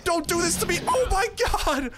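high, frantic pleading at the lagging computer; panicked outburst. Real, not acted. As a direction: yelp it out as the game falls apart. Computer lags mid-fight, panicking.

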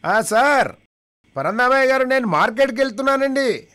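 a deaf villager hollering a question to a deaf friend; deaf man shouting. Retold or imitated. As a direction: shout as if the other can't hear. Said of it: "ఇద్దరు చెవిటి వాళ్ళే".

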